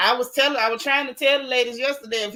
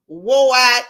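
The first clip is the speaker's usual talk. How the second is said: loud burst; 8 dB louder than usual. Acted. Warning the sisters about a used-up man, loud scornful burst. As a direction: blurt "wore out" out loud.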